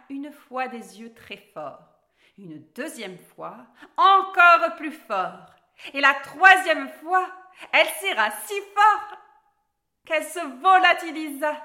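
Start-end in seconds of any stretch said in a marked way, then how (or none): 4.0-11.6 very loud, high, stretched-out narration; building intensity. A narrator's reading. Narrator tells Alice's ever harder blinks until she vanishes, building intensity.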